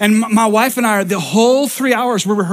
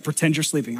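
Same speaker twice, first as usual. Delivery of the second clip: much softer, lower hushed instruction; whispered advice; 6 semitones lower, 9 dB quieter than usual. Retold or imitated. Wife whispering to husband in the dark, hushed and low. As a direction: hiss it quietly so nobody hears.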